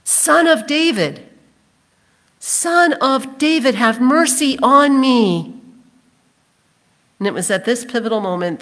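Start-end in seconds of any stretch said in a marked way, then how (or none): 0.0-5.6 high, drawn-out pleading call; acted beggar's cry, pitched high and slow; crying out loudly. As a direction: call out for mercy over a crowd. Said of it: "he cried out even more loudly".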